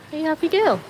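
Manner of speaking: high, affectionate dog voice; playful doggy question; pet talk. Real, not acted. Asking the dog, first take.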